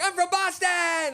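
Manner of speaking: high, drawn-out mock yell; stretched, high-pitched imitation of a whooper; screaming Bostonian. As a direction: holler it slowly like a proud overexcited tourist. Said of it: "started screaming".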